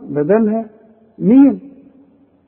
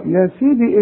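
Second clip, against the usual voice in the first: ordinary talk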